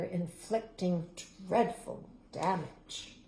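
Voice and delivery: ordinary delivery, nothing marked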